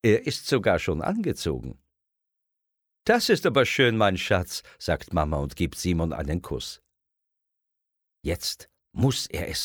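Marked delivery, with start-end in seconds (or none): 3.0-4.6 pleased mother. A narrator's reading. Mama praises Simon.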